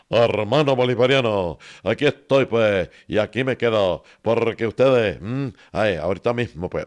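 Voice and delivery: speechify like Chávez addressing the crowd; Hugo Chávez speech. Retold or imitated. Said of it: "Hugo Chávez". (imitando a Hugo Chávez en discurso)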